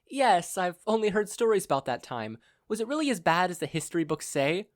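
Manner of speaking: polite captive mother. Acted. Blindfolded mother politely interrupts her kidnapper. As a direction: interrupt with mock courtesy.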